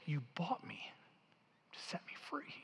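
breathless whisper, sure of it now; whispered conviction. Acted. Freed woman whispering to her rescuer, awed conviction. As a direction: whisper it as the truth lands. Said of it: "with more conviction".

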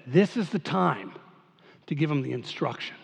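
a hint of the author musing quietly; author's voice. Pastor voicing the biblical author deciding to pause, soft musing. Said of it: "and he says".

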